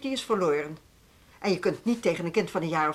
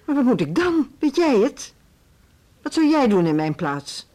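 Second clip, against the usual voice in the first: louder, higher, pressing questions; exasperated challenge; 3 semitones higher, 5 dB louder than usual. Acted. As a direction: throw the problem back at them. Director at a loss, challenging teacher.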